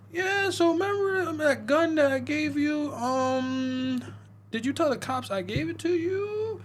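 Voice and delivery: high, slow put-on voice of the work buddy; mock buddy voice. Retelling a movie to friends, mock high coworker voice.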